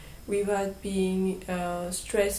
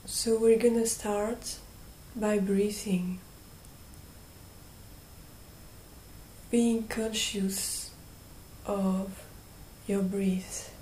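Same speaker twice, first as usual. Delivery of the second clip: guided meditation; 9 dB quieter than usual. Real, not acted. Guide leading listeners through breathing and calling on angels, hushed meditation voice. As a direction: gently guide them, leaving long silences between phrases.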